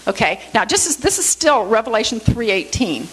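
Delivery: ordinary talk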